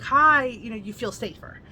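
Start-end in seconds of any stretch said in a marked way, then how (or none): 0.0-0.4 bright, loud, high hello; a sweet, reassuring greeting to strangers; friendly woman's hello. Retold or imitated. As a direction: wave them down with a cheery hi. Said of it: "a woman with him being like".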